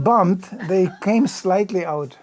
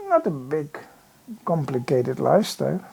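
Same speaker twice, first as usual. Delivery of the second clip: ordinary talk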